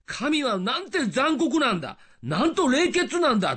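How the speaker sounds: high-pitched protesting exclamation; indignant dismay. Retold or imitated. A reader appalled by the Bible, indignant.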